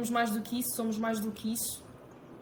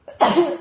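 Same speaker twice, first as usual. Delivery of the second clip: sudden loud sneeze; one loud real sneeze; sneezing; 5 semitones higher, 14 dB louder than usual. Real, not acted. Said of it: "vou espirrar".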